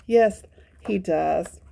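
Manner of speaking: ordinary delivery, nothing marked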